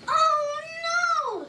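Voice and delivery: high, shocked oh no; dismayed high cry; alarmed gasp. Acted. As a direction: mom realizing the baby is at school. Mom's alarm.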